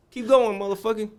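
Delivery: high, loud mock taunt; defiant retort. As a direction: dare him to keep going. Defying the guard.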